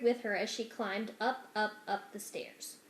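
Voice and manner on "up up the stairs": ordinary delivery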